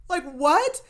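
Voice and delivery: high, loud incredulous what; shocked disbelief. Real, not acted. Host reacts to the bully, stunned and loud. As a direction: react as if you can't believe it.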